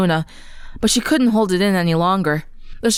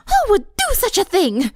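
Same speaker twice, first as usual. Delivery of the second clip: much higher, indignant, seething; angry hiss; 12 semitones higher than usual. Acted. Rarity seethes at whoever wrote the insult, angry. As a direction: ladylike outrage through her teeth. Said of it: "Rarity hissed".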